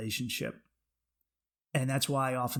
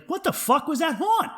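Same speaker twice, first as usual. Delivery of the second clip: high, loud, baffled outburst; retold bewilderment; 14 semitones higher, 6 dB louder than usual. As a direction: replay your disbelief, pitched way up. Acted baffled reaction in story.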